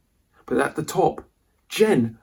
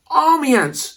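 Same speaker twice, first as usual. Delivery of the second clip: high, alarmed cry of warning; sudden high panicked call; 4 dB louder than usual. Acted. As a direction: yelp it out as the ants bite. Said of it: "she cried".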